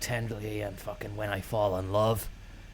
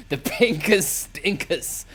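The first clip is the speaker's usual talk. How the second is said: laughing; 6 semitones higher, 4 dB louder, slower than usual. Shown as a demonstration. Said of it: "I call it".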